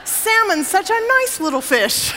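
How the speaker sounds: high, hammed-up coaxing; mock coaxing server. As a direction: imitate the server's sing-song persuasion. Repeats server's coaxing for laughs.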